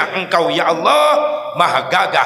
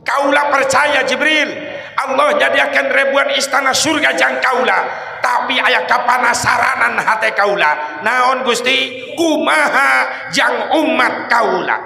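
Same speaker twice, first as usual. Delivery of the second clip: impassioned preaching; 6 semitones higher than usual. Acted. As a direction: plead at full force for the people. Preaching at full force.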